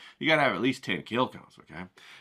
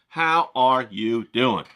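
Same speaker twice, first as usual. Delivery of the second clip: slow, stretched how are you doing; drawn-out greeting; 3 dB louder, much slower than usual. Shown as a demonstration. Repeats greeting slower, louder.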